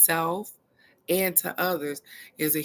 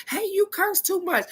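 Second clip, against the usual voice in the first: much higher, prissy mock reprimand; mock scolding boss; 12 semitones higher than usual. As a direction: mimic the prim colleague. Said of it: "I have been told".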